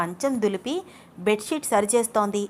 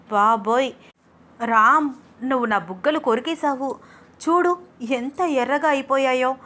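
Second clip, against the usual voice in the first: playful complaint; 5 semitones higher, 3 dB louder than usual. A narrator's reading. Wife's playful complaint, mirror.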